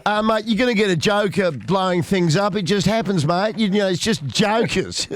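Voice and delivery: put-on Australian accent, casual; Australian police officer. Host mimics Australian police on bushfires, in an Australian accent. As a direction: shrug it off like an Aussie cop. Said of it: "they talk to the police and they go".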